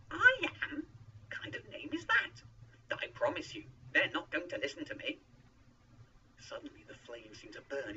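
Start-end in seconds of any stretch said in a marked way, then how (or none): 0.1-5.2 high, loud disbelief; incredulous objection. A narrator's reading. Moses questions God's strange name, incredulous. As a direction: object loudly, starting very high.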